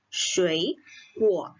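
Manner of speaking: "Shui" rising in second tone, "guo" low; tone sandhi shui guo. Shown as a demonstration. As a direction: show the changed tone on "Shui". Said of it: "Makanya kita bacanya". ("Shui" naik dengan nada dua)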